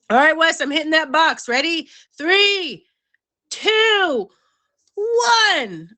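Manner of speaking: high, loud countdown; excited countdown. Real, not acted. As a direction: count him in with a bright high call. Countdown cue to co-host.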